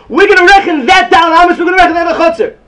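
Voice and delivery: high, loud declaring voice as the sages; the sages proclaiming their decree; acted Chazal ruling. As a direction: announce the ruling like a proud decree. Chazal quoted as ruling aloud.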